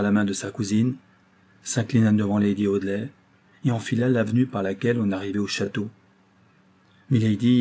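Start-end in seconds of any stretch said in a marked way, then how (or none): none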